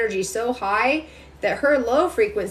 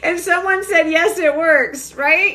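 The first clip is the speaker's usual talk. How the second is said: higher and louder, laughing through it; 5 semitones higher, 4 dB louder than usual. Real, not acted. Reading a viewer comment aloud, laughing. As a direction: bubble over with laughter as you read.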